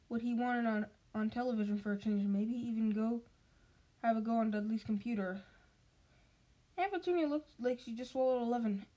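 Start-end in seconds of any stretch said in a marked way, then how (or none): none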